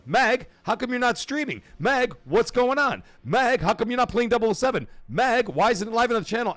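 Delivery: high, loud whiny mock pestering; mock impatient demands; pestering viewers. Voicing a flood of pestering messages.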